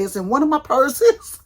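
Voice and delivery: loud, high and rising, flustered. Retold or imitated. Answering husband on the phone, loud flustered rise. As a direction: blurt it out in a rush of panic.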